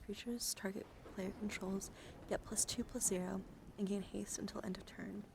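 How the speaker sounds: soft whispered ASMR card reading. Acted.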